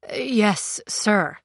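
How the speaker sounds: lightly rusty voice; understated, dry-throated rustiness. A narrator's reading. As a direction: answer with a touch of rust in the throat. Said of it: "his voice rusty".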